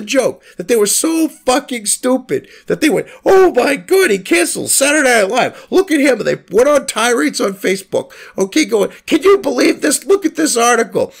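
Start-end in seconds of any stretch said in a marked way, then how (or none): 3.2-6.2 higher, louder imitation of a gullible outrage; hammed-up mock alarm. Mocking outraged leftists. 9.1-11.1 hammed-up mock indignation. Mocking leftists on Facebook.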